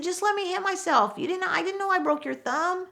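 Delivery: high-pitched defensive protest; retold protest. Gail answering the boss about his broken thumb, protesting.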